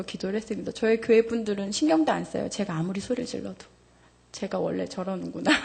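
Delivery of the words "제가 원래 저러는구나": normal talking voice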